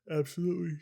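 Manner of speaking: said through a yawn. Real, not acted. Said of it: "Sorry, pardon my yawn".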